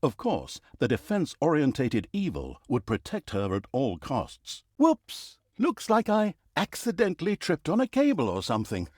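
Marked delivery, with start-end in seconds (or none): 4.8-8.3 high, hammed-up fake oops; feigned innocent surprise, pitched way up; mock whoops. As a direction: pretend it slipped by accident. Narrator fakes an accident.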